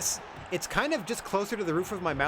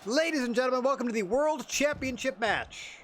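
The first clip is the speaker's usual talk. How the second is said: big booming announcer intro, pitched up; ring announcer; 8 semitones higher than usual. Acted. Host opening the championship match, booming announcer voice. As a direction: welcome the crowd like an arena announcer.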